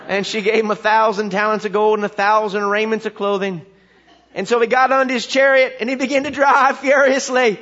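country preacher in full sing-song flow; backwoods preacher storytelling. Acted. Country preacher telling a jumbled Bible story, rousing backwoods voice. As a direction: rattle off the tale with rising revival-style gusto. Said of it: "he starts to tell this story".

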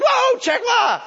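high, loud startled shout; mock panicked wife yelling from the passenger seat; imitating wife. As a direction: yelp like a jumpy passenger. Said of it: "she'll go".

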